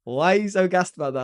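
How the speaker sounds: high, loud mock bafflement; incredulous. Local friend questions his Mustang excitement, incredulous. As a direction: imitate a friend who can't see the fuss.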